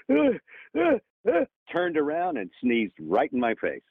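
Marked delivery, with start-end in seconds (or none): 0.1-1.4 much higher, stuttering pre-sneeze gasps; imitated sneeze build-up. Star director about to sneeze at the actor behind him, gasping high. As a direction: mimic someone about to sneeze. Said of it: "Tom Hanks goes".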